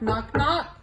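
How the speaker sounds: usual speaking voice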